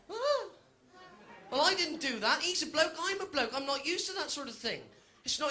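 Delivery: ordinary delivery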